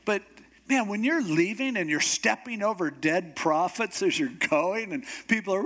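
usual speaking voice

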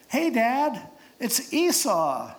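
high, drawn-out effeminate voice; voz afeminada. Acted. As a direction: pose as your brother in a high, unconvincing voice. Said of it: "You kinda have that effeminate voice".

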